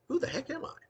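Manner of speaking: high, lost voice asking itself; bewildered wondering. Retold or imitated. Actor waking up confused, asking himself in a high voice. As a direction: squeak out the question in sudden confusion. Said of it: "only to wonder".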